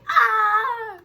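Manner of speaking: high, loud mock scream; imitated scream. Campers with pool noodles screaming at arrivals, mock scream. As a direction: mimic campers screaming in one drawn-out cry. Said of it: "guys and girls with pool, pool noodles screaming".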